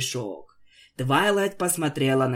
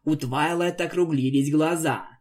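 plain reading voice

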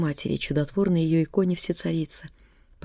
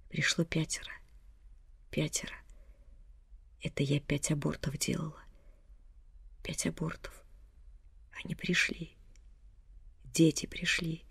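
very soft, halting whisper; faint confession whisper; 11 dB quieter than usual. A narrator's reading. Dying mother whispers her guilt to her daughter. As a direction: gasp out the confession.

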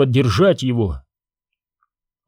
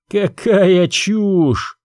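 drawn-out, slow scoff, higher; scornful snort; 7 semitones higher, much slower than usual. Acted. Prince dismissing Obi-Wan's claim, slow scornful scoff. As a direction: scoff at the nonsense. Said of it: "презрительно фыркнул".

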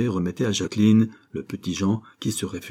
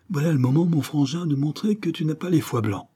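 bright, higher coaxing push; rally the scared kid, higher and eager; a street kid pumping up a little boy, higher and urgent; egging him on; 7 semitones higher than usual. Acted. Gangster boy urges the child on.

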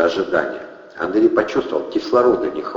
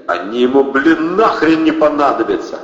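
loud, higher, creaking protest; creaky; 7 semitones higher, 5 dB louder than usual. A narrator's reading. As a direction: grate it out angrily. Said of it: "заскрипел Протасов". (скрипучим голосом, громко)

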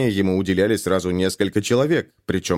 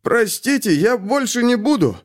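whiny pleading; 13 semitones higher than usual. A narrator's reading. Scared thief pleading.

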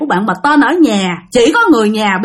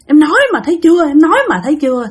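told you so; 6 semitones higher, faster than usual. Retold or imitated. Aunt's told-you-so to the mother.

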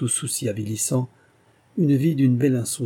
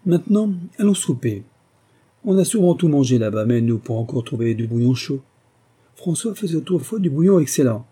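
higher, louder gossiping hostess; eager society hostess; 4 semitones higher, 4 dB louder than usual. Acted. Hostess presses the palmist for details, eagerly. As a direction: push for the juicy details, pitched up.

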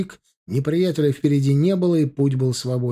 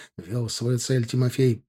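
plain reading voice

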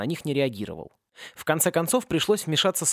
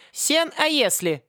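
announcing; 12 semitones higher, slower than usual. A narrator's reading. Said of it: "объявил аспирант".

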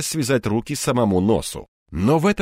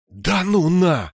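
higher, slow stretched exclamation; disbelief; 7 semitones higher, much slower than usual. A narrator's reading. Narrator's startled thought as disaster looms, drawn out in disbelief. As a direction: drag it out in disbelief. Shocked thought as barrel is doused.

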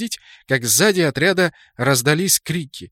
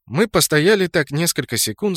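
plain reading voice

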